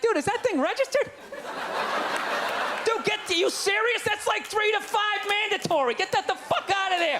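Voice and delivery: high alarmed whine of the entourage friend. Retold or imitated. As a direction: freak out as the dry itchy friend. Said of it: "itching, like".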